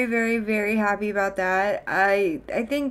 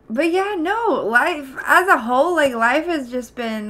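normal talking voice

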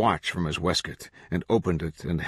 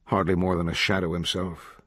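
ordinary delivery, nothing marked

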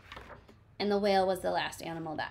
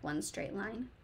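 usual speaking voice

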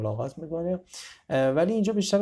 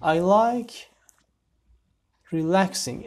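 announced sentence; 6 semitones higher, 5 dB louder, much slower than usual. Shown as a demonstration. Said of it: "teacher announces new sentence".

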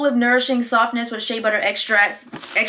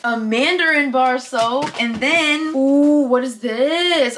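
usual speaking voice

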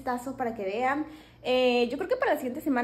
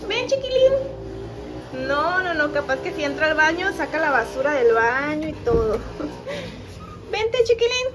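calling the puppy; 6 semitones higher than usual. Real, not acted. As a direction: call the puppy over sweetly.

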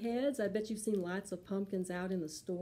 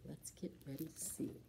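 put-on hushed whisper to the children; soft storyteller whisper; 3 semitones lower, 12 dB quieter than usual. Shown as a demonstration. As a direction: whisper it like a secret. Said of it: "unvoiced whisper".

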